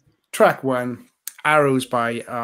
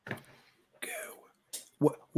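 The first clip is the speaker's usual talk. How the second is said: usual speaking voice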